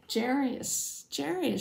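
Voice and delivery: understated cry of alarm, as narrated; mild alarmed cry. A narrator's reading. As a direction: call out for a sleeping husband, mildly. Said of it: "she cried".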